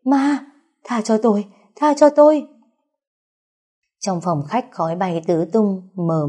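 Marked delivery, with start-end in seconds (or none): 0.0-2.5 high, loud plea for mercy; terrified scream. A narrator's reading. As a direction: cry out as if seeing a ghost, rising to a shout. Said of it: "có tiếng hét của Vũ Huệ".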